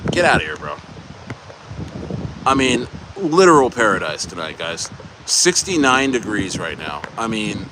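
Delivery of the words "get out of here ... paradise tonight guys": ordinary delivery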